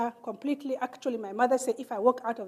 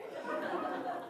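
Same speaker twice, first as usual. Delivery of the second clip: laugh; 11 dB quieter than usual. Real, not acted.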